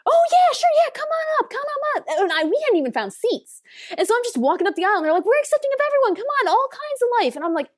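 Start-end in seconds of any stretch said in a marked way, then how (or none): 0.1-2.1 imitating an eager host, much higher; mock-gushing "come on up", pitched way up; eager welcome. Organizers eagerly calling her up. 5.3-7.4 imitating an eager host, much higher; mock-gushing "come on up", pitched way up; eager welcome. Organizers mock-welcoming her on stage.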